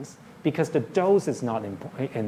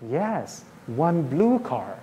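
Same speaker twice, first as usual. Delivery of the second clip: put-on slow, drawn-out reply to a small child; deliberate, lilting parent-to-child expansion; parent modelling speech; much slower than usual. Shown as a demonstration. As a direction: stretch out each word for a child learning to talk. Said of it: "if the child says car, you say".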